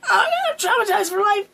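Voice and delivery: high, whiny mock crying; fake-sob the line for laughs; hammed-up crying wail. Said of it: "And I'm like".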